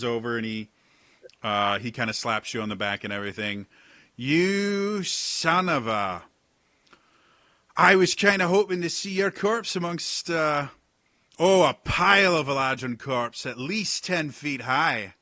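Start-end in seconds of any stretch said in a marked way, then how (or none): 4.2-15.1 loud, high, slow hearty sea-dog; rough pirate captain. Acted. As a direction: rib an old friend boisterously. Pirate captain greets old friend.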